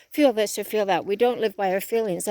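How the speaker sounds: normal talking voice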